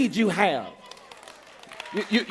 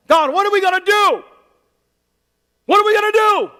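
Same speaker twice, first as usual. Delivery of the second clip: high, loud, panicked cry to God; desperate plea; 11 semitones higher, 6 dB louder than usual. Retold or imitated. Captives crying out to God in panic. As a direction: wail it like helpless captives.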